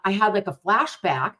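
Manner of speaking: normal talking voice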